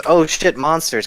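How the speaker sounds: ordinary talk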